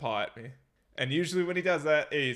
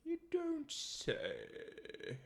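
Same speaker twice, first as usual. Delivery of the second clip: much higher, very slow dainty toff; posh teacup voice; 13 semitones higher, 10 dB quieter, much slower than usual. Pretend. Host with pinky up greeting listeners, posh mock voice. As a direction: drawl it out like a fop sipping tea. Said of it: "holding an imaginary teacup with his pinky up".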